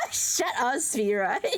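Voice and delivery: normal talking voice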